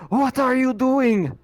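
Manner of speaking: sharp, high, loud shout down the hall; startled shout. Acted. Doctor shouting at Rachel across the hallway, alarmed. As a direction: bark it in alarm from far off.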